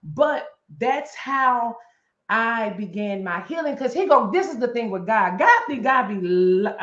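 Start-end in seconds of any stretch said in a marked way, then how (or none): none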